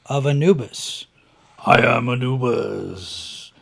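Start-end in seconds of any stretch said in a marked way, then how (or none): 1.7-3.5 booming, slow villain proclamation; over-the-top dark lord. Retold or imitated. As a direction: declaim it like a hammy hologram god. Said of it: "he actually does go over the top with this".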